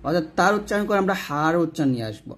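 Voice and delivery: normal talking voice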